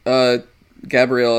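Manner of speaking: normal talking voice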